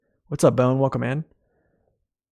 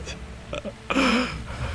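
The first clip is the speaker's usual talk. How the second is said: real laugh; 11 semitones higher, 6 dB quieter than usual. Real, not acted. Laughing at his own attempts.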